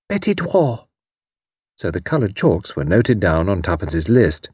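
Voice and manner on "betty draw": lightly voiced toddler, higher; small child. A narrator's reading. Little girl answering Tuppence, childish. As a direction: chirp it like a tiny girl.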